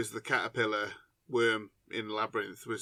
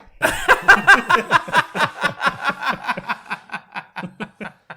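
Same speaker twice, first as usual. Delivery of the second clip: loud, high, long burst of laughter; uproarious cackling laugh; big belly laugh; 13 dB louder than usual. Real, not acted. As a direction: crack up helplessly, peal after peal. Listener cracks up at joke.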